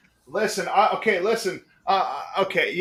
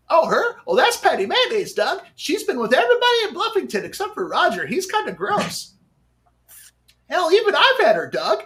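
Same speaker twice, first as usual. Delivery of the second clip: high cartoon kid voice; a high nasal-free cartoon sidekick greeting; Skeeter from Doug; 9 semitones higher, 3 dB louder than usual. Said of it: "cartoon voices like Skeeter".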